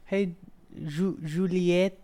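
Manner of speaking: ordinary talk, nothing marked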